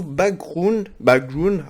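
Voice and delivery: English word with a real French accent. Real, not acted. Tutorial maker reading a menu label aloud, French-accented English. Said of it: "désolé, mon accent anglais".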